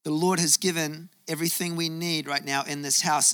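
ordinary talk, nothing marked

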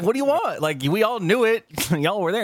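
high, loud what do you want; exasperated grandson. Retold or imitated. To grandma about the divorce, exasperated and high. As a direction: blurt it out, laughing a little. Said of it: "And I was like".

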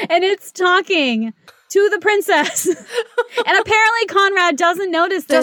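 laughing high in disbelief. Real, not acted. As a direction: giggle through the words.

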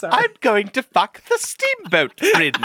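high, slow mock repeat. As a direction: parrot it back, laughing.

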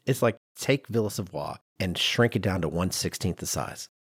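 ordinary talk, nothing marked

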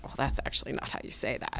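a hushed fussy correction, quick; mocking whisper. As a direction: whisper it fast and snooty. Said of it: "we don't sit there and go like".

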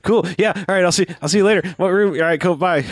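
high, quick and overeager; eager excited voice. Retold or imitated. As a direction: brush her off cheerfully. Donnie eager, voiced in recap.

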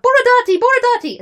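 very high, loud repeated cry; rival crowd shout. Acted. Rival faction shouting its candidate's name, shouted.

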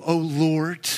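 normal talking voice

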